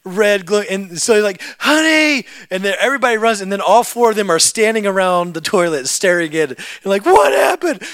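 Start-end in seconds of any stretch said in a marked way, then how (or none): 1.6-2.2 high loud panicked call. Retold or imitated. Father shouting for his wife over the toilet. 7.0-7.8 high loud panicked question; alarmed shout. Retold or imitated. Family staring into the toilet, alarmed.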